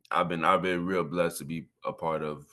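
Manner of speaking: normal talking voice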